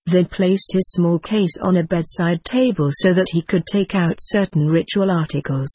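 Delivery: ordinary delivery, nothing marked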